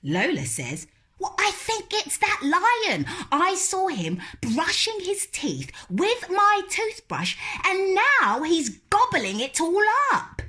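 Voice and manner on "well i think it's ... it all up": put-on high, stubborn little-girl voice, louder; little girl refusing. Lola refusing to go to bed, high and stubborn. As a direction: dig in like a stubborn small child.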